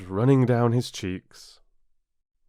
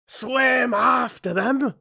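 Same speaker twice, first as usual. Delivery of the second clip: much higher, loud slow scream; Griffin screams; 6 dB louder, slower than usual. A narrator's reading. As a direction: scream it wildly. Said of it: "screamed the Griffin".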